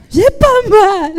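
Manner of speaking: high, loud and slow; loud slow take. Acted. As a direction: belt it out slowly.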